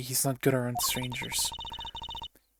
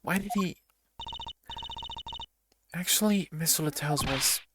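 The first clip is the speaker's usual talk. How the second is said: ordinary delivery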